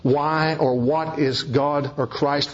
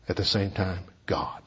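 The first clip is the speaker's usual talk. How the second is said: breathy whisper, much softer; hushed whisper; 8 semitones lower, 8 dB quieter than usual. Real, not acted. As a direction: let it drop to an awed whisper. Awe at Christ's divinity.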